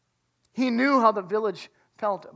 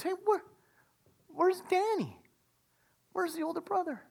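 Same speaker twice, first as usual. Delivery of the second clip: higher puzzled calling; curious asking; 8 semitones higher, 6 dB quieter than usual. Retold or imitated. Father at the party asking for his older son, higher. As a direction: look around the party and ask.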